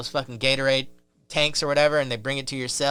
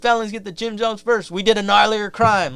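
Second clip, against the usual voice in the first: indignant felons; 6 semitones higher than usual. Retold or imitated. Felons demanding to be served first.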